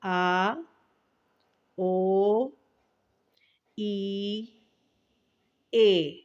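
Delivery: آ، او، ای، اے each held long, one by one; vowel length demo. Shown as a demonstration. Teacher giving pupils the right vowel length. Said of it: "اس کی صحیح آواز اور اس کی صحیح مقدار".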